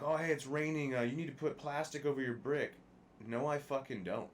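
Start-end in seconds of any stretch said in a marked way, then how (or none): 0.0-2.7 mock builder request. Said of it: "these people are like".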